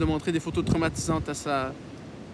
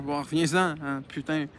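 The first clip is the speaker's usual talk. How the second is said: usual speaking voice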